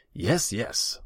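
high, loud impatient huff; exasperated royal dismissal. A narrator's reading. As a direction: brush the advisor off impatiently. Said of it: "Tasigur huffed".

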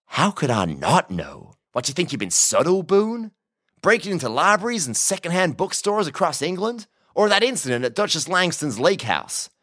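smirking American cowboy. A narrator's reading. Prisoner lists Boone's break-ins, smugly taunting. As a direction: needle him with smug, theatrical disbelief.

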